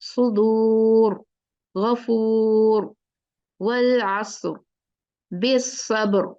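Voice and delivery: each word slow and drawn out, heavy र; slow recitation drill. Shown as a demonstration. Teacher drills the examples with heavy ra. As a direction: recite the words one by one, slowly. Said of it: "recitation drill".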